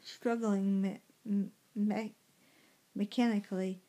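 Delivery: usual speaking voice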